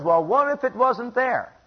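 high-pitched, slow challenge; doubting questioner. Acted. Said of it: "And someone says".

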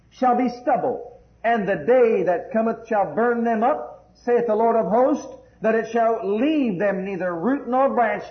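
loud, slow, ringing declamation; full-force scripture proclamation. Acted. Preacher proclaims the day of judgment to the congregation, full-force declamation. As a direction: thunder the verses out with stretched key words.

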